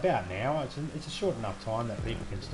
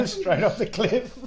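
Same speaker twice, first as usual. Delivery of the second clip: laughing; 12 semitones higher, 9 dB louder, slower than usual. Real, not acted. Laughing after crash.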